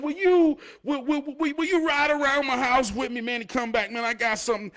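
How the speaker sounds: stammering very high, loud falsetto plea; begging neighbour. Retold or imitated. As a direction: plead in a squeaky, stuttering neighbour voice. Neighbour begs for a ride.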